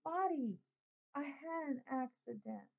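a small boy confessing, lightly voiced; little brother admits wetting the bed. A narrator's reading.

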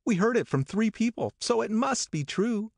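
insist, high and eager; Daisy insisting. A narrator's reading.

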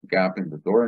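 normal talking voice

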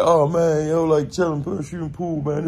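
lightly voiced chill inmate; imitating the guy. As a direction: play him laid-back and casual. Voicing the guy's reply.

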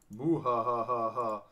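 slow hammed-up villain laugh; evil chuckle. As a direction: cackle like a cartoon villain. Villain laugh after destroying card.

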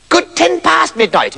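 high, slow squeaky gnome voice; christmas elf. Acted. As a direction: stretch it out like a tiny elf. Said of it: "it said".

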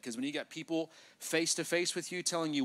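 normal talking voice

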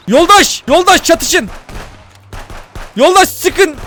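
rally the comrades to fire; urgent shouting. Real, not acted. Streamer urges comrades to shoot, loud calls.

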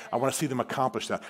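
normal talking voice

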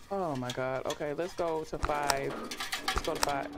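usual speaking voice

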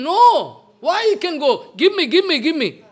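sudden high, loud urgent cry; Gaur Kishore Babaji crying out. Retold or imitated. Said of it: "Gaur Kishore Babaji".

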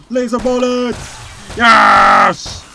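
high, loud gamer's battle cry; excited shouting. Real, not acted. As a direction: shout it out in triumph. Excited shooting in game.

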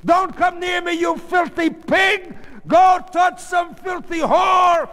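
high, loud screamed insults; furious scream. A narrator's reading. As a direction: scream him away in disgust and rage. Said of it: "she screamed".

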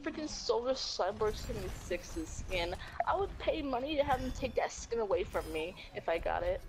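ordinary delivery

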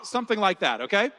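ordinary talk

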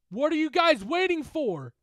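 desperate shout. Acted. Bitten bully shouting at friends to act. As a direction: demand help at the top of your voice. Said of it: "Derek shouted".

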